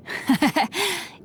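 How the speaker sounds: high light laugh; short, high-pitched chuckle; amused laugh. Acted. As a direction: laugh at a friend's idea. Amused at the novel title idea.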